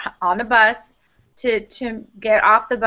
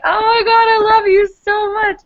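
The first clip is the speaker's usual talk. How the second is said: gushing overwhelmed; 12 semitones higher, 5 dB louder than usual. Retold or imitated. Overwhelmed by waitress's kindness.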